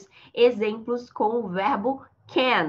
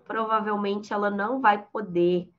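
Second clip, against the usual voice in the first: usual speaking voice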